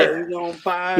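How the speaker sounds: a playful announcer echo; NBA Jam announcer. Retold or imitated. Friend echoing the NBA Jam announcer call. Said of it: "N B A Jam".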